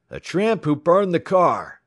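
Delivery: loud, high accusing shout; high-pitched yell from a crowd; mob cry. A narrator's reading. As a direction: raise the cry against him. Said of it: "rose the cry".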